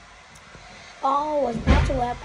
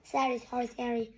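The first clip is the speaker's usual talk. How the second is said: usual speaking voice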